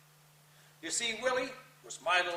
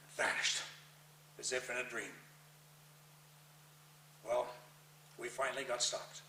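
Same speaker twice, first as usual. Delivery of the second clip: much softer, drained; hushed; 9 semitones lower, 13 dB quieter than usual. Acted. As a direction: let it fall away after the shock. After the vision, hushed.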